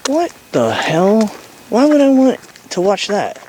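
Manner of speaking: high, hammed-up indignant squeal; mock outrage. Mock outrage at a show suggestion.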